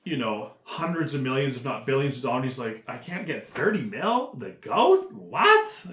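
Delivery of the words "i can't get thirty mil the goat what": mock outrage. Imitating Jones's disbelief over money.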